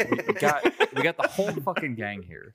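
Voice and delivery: words breaking into laughter; laughing while talking. Real, not acted. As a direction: laugh through it. Laughing at nickname joke.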